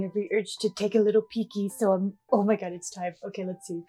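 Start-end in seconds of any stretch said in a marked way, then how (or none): none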